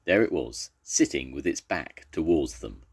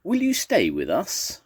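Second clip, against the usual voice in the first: high, louder blurted question; blurted plea; 13 semitones higher, 5 dB louder than usual. A narrator's reading. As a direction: pluck up courage and ask it out high. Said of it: "paused and took a deep breath".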